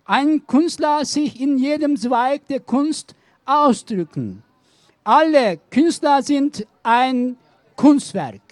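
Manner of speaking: ordinary talk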